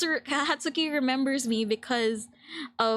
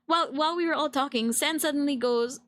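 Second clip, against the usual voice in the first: ordinary talk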